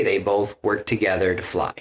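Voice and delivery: ordinary talk, nothing marked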